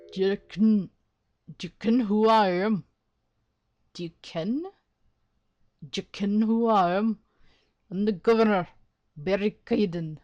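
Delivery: Irish accent attempt. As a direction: announce yourself in an attempted Irish brogue. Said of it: "Irish, no!".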